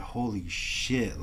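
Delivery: slow, hushed amazement; retold quiet disbelief; awed. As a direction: draw it out softly, stunned. Retold awe at internship.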